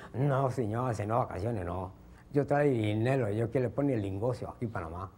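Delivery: low, put-on Chinese accent; broken Spanish in a mock Chinese accent. Traveller tells the officer he brings money, mock Chinese accent. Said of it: "llega un chinito al aeropuerto".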